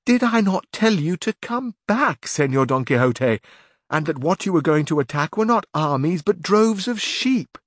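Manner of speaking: a rustic squire chiding his master, I told you so; Sancho the squire. A narrator's reading. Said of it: "And said he".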